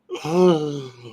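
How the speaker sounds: a sharp, high mock gasp of offence; mock indignant gasp. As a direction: gasp as if scandalised. Mock outrage at being challenged.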